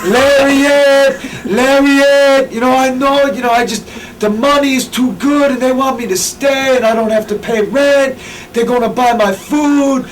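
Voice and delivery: high, put-on voice, far from his own; fake prank-call voice. Prank-calling Gabe in disguise, high put-on voice. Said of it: "I didn't even use my real voice".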